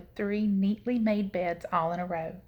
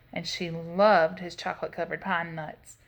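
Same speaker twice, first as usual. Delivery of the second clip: ordinary delivery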